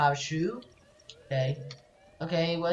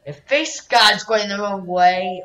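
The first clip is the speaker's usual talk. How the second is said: ordinary talk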